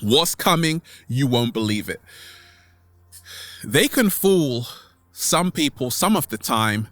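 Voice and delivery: normal talking voice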